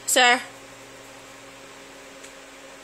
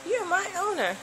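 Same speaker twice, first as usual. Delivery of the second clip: higher, softer voice for the dog, lightly done; voicing the dog; 7 semitones higher, 6 dB quieter than usual. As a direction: pitch it up as a sheepish pup. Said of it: "He's like".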